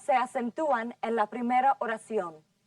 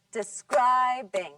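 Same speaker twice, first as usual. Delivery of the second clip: slow "Describing", middle syllable long and strong; stress on second syllable; 3 dB louder, much slower than usual. Shown as a demonstration. Teacher has class clap the word, "Describing" stressed on the second syllable. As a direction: lean hard on SCRIB in "Describing". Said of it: "Listen and clap".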